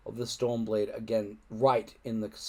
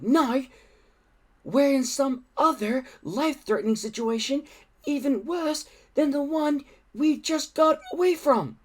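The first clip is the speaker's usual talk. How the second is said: put-on panicky boy; 12 semitones higher than usual. A narrator's reading. Fishlegs to his friends, panicking ever higher. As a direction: climb higher into panic with each clause.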